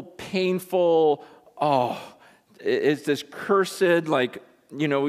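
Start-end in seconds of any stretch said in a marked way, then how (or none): none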